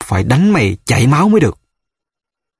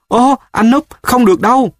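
protesting cry; 11 semitones higher than usual. A narrator's reading. The boss protests to Núp, a high alarmed cry. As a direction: exclaim in alarm, much higher. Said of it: "Sếp kêu".

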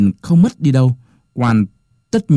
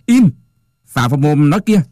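angry bark; 4 semitones higher, 3 dB louder than usual. A narrator's reading. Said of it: "quan trợn mắt quát".

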